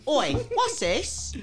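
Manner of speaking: high, slow mock Cockney challenge; jokey high Cockney accent. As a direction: drag it out in hammed-up Cockney. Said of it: "You go".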